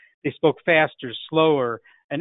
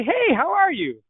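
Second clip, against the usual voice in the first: high, slow, glad friend's greeting; friendly greeting; 12 semitones higher than usual. Shown as a demonstration. Friend on the phone greets the caller high and slow. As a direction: greet them like a long-lost friend. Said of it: "And then they say".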